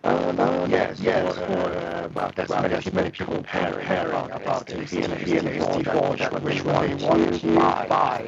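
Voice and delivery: robotic, choppy breaking-up voice; glitching audio. Real, not acted. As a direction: voice cutting out over a bad connection. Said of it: "Audio is kinda breaking up".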